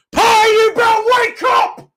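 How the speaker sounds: high, loud furious shout at the player; angry yell. Real, not acted. Fan yelling at Partey to wake up, real angry shout. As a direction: bark it like a fed-up fan.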